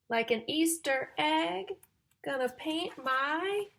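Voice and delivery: loud, slow, sing-song storybook reading; reading to children. Acted. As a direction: build suspense before each rhyme. Reading rhyming picture book.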